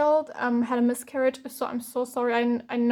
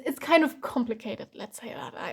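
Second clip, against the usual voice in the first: usual speaking voice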